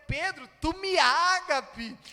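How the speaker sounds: loud, high preaching challenge; emphatic confrontation. Acted. Jesus challenging Peter about his boasted sacrificial love, emphatic confrontation. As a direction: press the question forcefully, high and loud.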